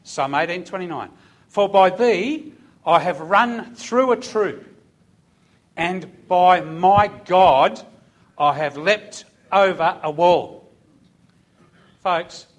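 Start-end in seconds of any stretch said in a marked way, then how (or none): 1.5-10.7 slow, loud preaching read; scripture reading. Acted. Preacher reads the psalm to the congregation, slow and loud. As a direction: proclaim the verse slowly. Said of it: "Just say that again".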